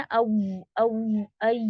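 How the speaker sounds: औ and ऐ stretched and overdone; exaggerated औ ऐ as a bad example; wrong diphthong demo. Shown as a demonstration. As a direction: show how not to say औ and ऐ. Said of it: "ऐसे कुछ नहीं करना".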